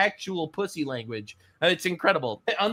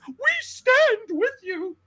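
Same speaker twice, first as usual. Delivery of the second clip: high, drawn-out mock solidarity; hammed-up pious pledge in a much higher voice; mocking liberal; slower than usual. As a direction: draw it out in a mocking falsetto. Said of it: "All the libtards are like this".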